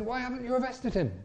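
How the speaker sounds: high, demanding question from an angry official; indignant leader. Retold or imitated. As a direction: press them hard, mock outrage. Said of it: "the guy said".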